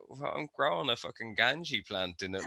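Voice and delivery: dry and matter-of-fact, dropping the volume; deadpan reply. Retold or imitated. Answering her husband in a soft deadpan voice. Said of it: "I was like".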